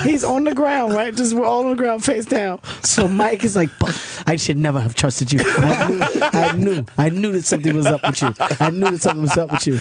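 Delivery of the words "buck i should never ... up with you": imitated African accent, accusing a friend; fast African-accented mock accusation. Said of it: "it's African voice".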